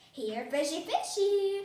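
singsong coaxing, rising high and loud; coaxing call. A narrator's reading. Amelia Bedelia in the water calling the fish, coaxing. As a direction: call a little creature to come.

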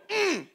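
high, tight-lipped hum; closed-mouth "mm". Shown as a demonstration. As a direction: demonstrate a muffled hum with lips shut. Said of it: "you can't do this. Don't go".

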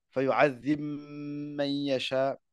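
"Fayu'adhim man" slowly, the m merged and held into "man"; slow, chanted recitation with the merge; idgham demo. Shown as a demonstration. Teacher reciting Ibn Kathir's reading, slow and chanted. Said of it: "il fait le idram".

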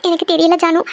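usual speaking voice

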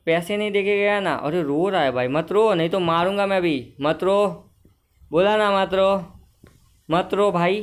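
play-acted bullying telling-off; mock scolding. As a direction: scold loudly in mock exasperation. Threatens a crying game customer.

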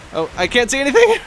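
high, loud exclamation; rising panicky yell; alarmed cry. Real, not acted. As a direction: cry out as you lose sight of the track. Blinded while racing.

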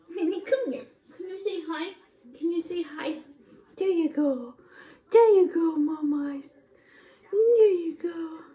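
high-pitched coaxing; sweet, high, playful encouragement. Real, not acted. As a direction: coo to a little one. Coos at pet or child.

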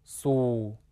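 "su" held long in a low, slightly sinking tone; second-tone demonstration. Shown as a demonstration. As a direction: model "su" twice, low and drawn out. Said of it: "加上二声声调符号".

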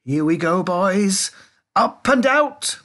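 high, loud, stretched rallying cry; triumphant launch call; exhilarated shout. A narrator's reading. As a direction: holler it as you blast off. Wonka launches lift through roof.

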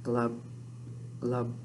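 low, soft glub glub fish sound; deep quiet glub. Pretend. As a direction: bubble it out like a little fish. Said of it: "You might say".

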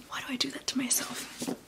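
rueful whisper to herself; whispered aside. Real, not acted. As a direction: whisper it under your breath. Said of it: "self-directed whispered aside".

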